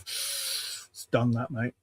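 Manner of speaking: slow, whispery mechanic's shrug; weary mechanic. Retold or imitated. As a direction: drawl it like a jaded mechanic. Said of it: "typical, um, mechanics one".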